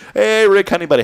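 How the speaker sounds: high, loud, hammed-up hello to Rick; playful loud greeting. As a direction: call out to him like a buddy across the room. Greeting co-host with nickname.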